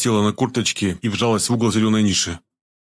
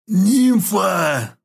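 high, loud strained cry; strained rasp; 10 semitones higher, 3 dB louder than usual. A narrator's reading. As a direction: force the word out through paralysis. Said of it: "прохрипел он с трудом".

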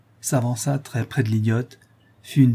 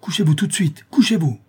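raised, higher sharp command; threatening order; 6 semitones higher, 4 dB louder than usual. A narrator's reading. Man with whip ordering the old woman down, threatening. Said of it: "en menaça l'idiote, lui disant".

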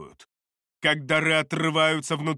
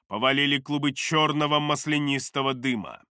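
ordinary delivery

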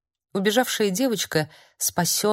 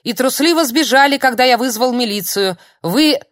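истеричный крик; 6 semitones higher, 7 dB louder than usual. Acted. Старик кричит в истерике.

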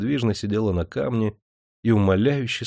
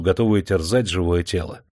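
ordinary delivery

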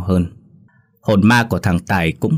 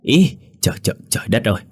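startled cry; 3 semitones higher, faster than usual. A narrator's reading. Drunk man woken on the bed protests to his friend, startled cry. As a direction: yelp in mild alarm, tripping on the first words. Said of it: "ông Năm Hữu cũng la lên".

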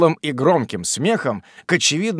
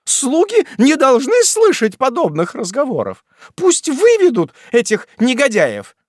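high, loud haughty order; high loud irritated order; irritated wife; 11 semitones higher, 6 dB louder than usual. A narrator's reading. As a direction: command it with disdain. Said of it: "раздражённая супруга".

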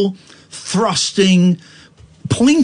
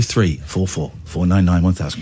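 deep low voice; 11 semitones lower, 5 dB quieter than usual. Shown as a demonstration. Number repeated much lower, quieter.

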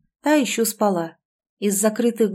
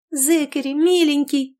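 higher excited yell; excited shout; 7 semitones higher than usual. A narrator's reading. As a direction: shout with delight at grandpa. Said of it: "кричали они".

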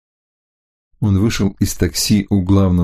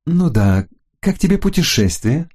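higher, eager female voice; woman's voice; 8 semitones higher than usual. Acted. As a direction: ask brightly, rising high. Florinda asks the hunter.

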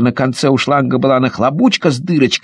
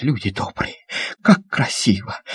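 awed; 4 semitones lower, 7 dB quieter than usual. Acted. Boy marvels softly.

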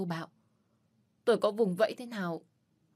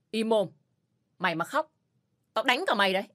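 louder barking threat; harsh yell; 3 semitones higher, 5 dB louder than usual. Acted. Man shouting at the crying little girl, harsh. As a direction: snap at the child to shut up. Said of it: "Mạnh quát vào mặt con bé".

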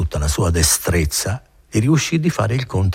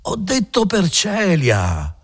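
innkeeper yelling; 13 semitones higher, much slower than usual. A narrator's reading. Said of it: "gridò questo sul viso di Renzo".

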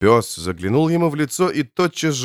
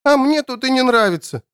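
put-on high, sulky little kid; sniffling child; 11 semitones higher than usual. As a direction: sniff through a pout. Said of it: "шмыгнул носом".

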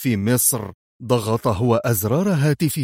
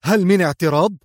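higher, louder shouted challenge; challenging shout; 6 semitones higher, 4 dB louder, faster than usual. A narrator's reading. Leader daring his aide to object, loud challenge. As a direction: turn on her and dare her to object. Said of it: "استدار إليها صائحا".

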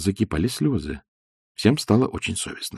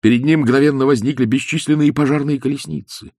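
plain reading voice

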